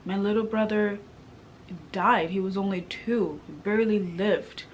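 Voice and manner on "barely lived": usual speaking voice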